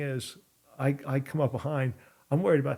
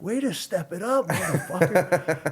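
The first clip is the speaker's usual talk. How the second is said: high, hyped-up congratulation, imitated; happy cheering; 5 semitones higher, 5 dB louder, slower than usual. Storyteller imitating the opponent high-fiving him after the fight, happy cheering. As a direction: whoop it like a high-fiving opponent, last word stretched. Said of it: "this guy was just high-fiving me".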